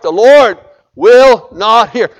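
emphatic preaching. Acted. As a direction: slam the verse down. Preacher drives home scripture.

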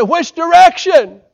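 high, loud alarmed call; shouted question. Retold or imitated. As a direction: yell it back in alarm.

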